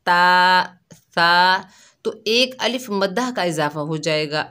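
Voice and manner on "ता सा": ता and सा held long with a louder drawn a; lengthened vowels. Shown as a demonstration. Teacher shows how lengthening adds an alif madda. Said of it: "अगर आप ज़बर को लंबा करेंगे".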